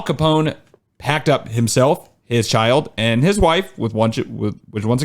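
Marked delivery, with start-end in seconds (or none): none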